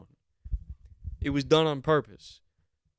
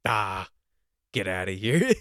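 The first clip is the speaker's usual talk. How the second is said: mock cop brush-off; 4 semitones lower, 5 dB louder than usual. Mocking the cop dismissing owner.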